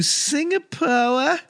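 Singapore stretched slowly, ending in "uh"; drawn-out Singapore. Acted. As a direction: draw out Singapore, dropping the final r. Place name drawn out in put-on way.

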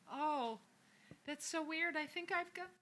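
high sing-song make-believe; hammed-up pretending. Roger telling his son he has lice too, hammed-up. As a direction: play up a high, drawn-out discovery. Acts Roger's fib to child.

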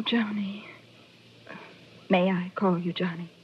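breathy hushed whisper; whispered flirting. Acted. Widow whispering to the detective, hushed and intimate. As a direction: draw him in softly.

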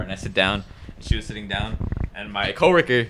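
normal talking voice

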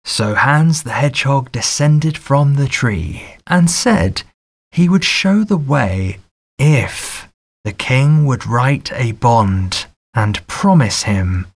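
ordinary delivery

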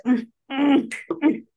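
playful high-pitched hum; teasing high hum. Pretend. Teasing hum before the reveal.

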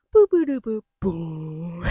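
slow, loud beatbox drop; vocal drop imitation. Shown as a demonstration. Guessing game for listeners, slow mouth-made drop. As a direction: sing the bass drop with your mouth. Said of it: "I'm gonna do a drop".

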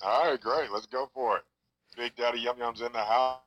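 ordinary delivery

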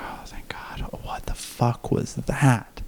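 whispered in shaky relief; hushed retold relief; relieved whisper. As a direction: breathe it out in disbelief. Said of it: "I'm like".

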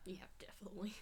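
breathy mock whisper. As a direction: whisper it like a secret. Joking agreement about smart talker.

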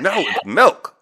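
"milk" with a clear short I, high; exasperated correction. Retold or imitated. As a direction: imitate wife insisting on proper "milk". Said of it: "She's like".

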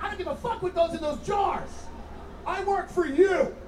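mock-aggressive salesman swagger in a job interview. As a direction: push it like a mortgage shark on a hippie. Comic acts out his aggressive self.

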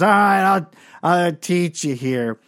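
loud, high start dragging into slow slur; drunken slur. Retold or imitated. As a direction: agree like a hammed-up drunk. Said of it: "slurred way".

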